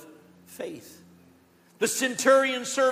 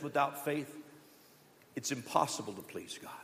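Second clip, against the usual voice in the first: hushed scripture; 7 semitones lower, 9 dB quieter than usual. Acted. Reads scripture in a hush.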